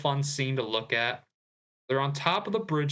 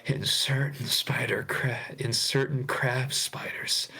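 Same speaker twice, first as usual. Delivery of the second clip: Discord mod voice. Acted. Host reading Peter's spider courtship lines, whispered Discord mod voice. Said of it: "I'm gonna do this in my Discord mod voice".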